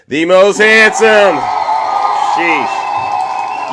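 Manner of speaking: loud, proud shout of the punchline; loud boastful shout; hype shout. Real, not acted. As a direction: bellow it like a wrestling promo. Punchline of host intro.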